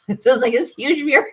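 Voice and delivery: high voice breaking up with laughter; laughing recollection. Real, not acted. Cracking up at own memory.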